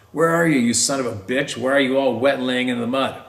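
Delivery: retold yell. Stepfather yelling at the boy in the mud, mock yell. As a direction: bark it down at the boy in the ditch.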